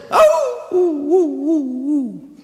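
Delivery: high imitated dog howl fading away; mock dog howl, dying off at the end. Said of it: "el perro empieza a aullar".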